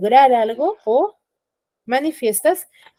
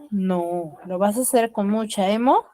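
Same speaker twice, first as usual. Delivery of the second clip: ordinary talk, nothing marked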